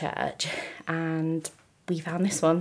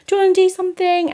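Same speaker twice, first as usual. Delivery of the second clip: high, quick, louder plea; hammed-up eager pleading; needy ask; 14 semitones higher, 8 dB louder, faster than usual. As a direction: pester a friend to come along. Quoting her needy past self.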